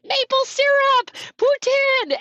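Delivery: very high, drawn-out mock cheering; cheering crowd. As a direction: call out like excited spectators, high-pitched. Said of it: "then people were like".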